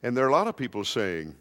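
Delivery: ordinary talk, nothing marked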